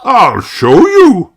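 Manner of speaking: put-on slow, high old rabbit voice; earnest answer; Grampy Rabbit. A narrator's reading. Grampy Rabbit answering Peppa, slow old voice.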